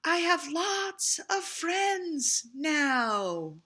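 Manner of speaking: high, slow, far-off echo; echo from across the pond. A narrator's reading. The echo answers Little Beaver from across the pond. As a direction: let it float back like an echo. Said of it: "From across the pond, a voice answered".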